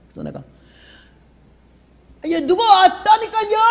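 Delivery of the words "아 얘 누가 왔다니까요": high-pitched calling shout. Retold or imitated. Wife yelling to an unanswering husband, high shout. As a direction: call out high, impatient. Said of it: "내가 소리 질렀더니".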